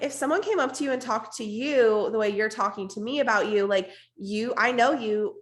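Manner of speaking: ordinary delivery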